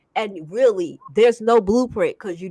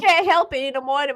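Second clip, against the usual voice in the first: usual speaking voice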